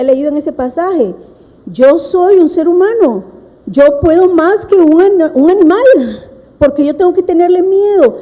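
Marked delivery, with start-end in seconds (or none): 1.7-8.2 determined self-talk. Retold or imitated. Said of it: "yo decía en mi mente".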